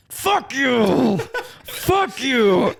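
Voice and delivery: very high, drawn-out imitated scream; mock angry yell. Homeless man threatening a passing car with bottles, mock furious yell. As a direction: yell it like a ranting stranger, hammed up.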